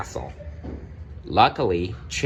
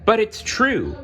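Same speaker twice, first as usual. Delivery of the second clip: high, louder insistent protest; 13 semitones higher, 4 dB louder than usual. Acted. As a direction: insist desperately. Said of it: "Belle protested".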